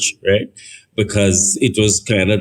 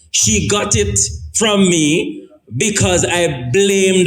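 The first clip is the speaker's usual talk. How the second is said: rising preacher intensity; 8 semitones higher than usual. Acted. Preacher pressing the congregation with his testimony, building. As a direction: pitch climbing as the story builds to its peak.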